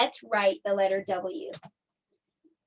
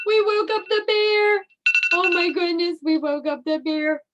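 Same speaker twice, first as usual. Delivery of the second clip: high, loud mock panic easing slightly; pretend alarm; 7 semitones higher, 4 dB louder than usual. Teacher who has woken a pretend bear, mock alarm. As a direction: play scared for young children.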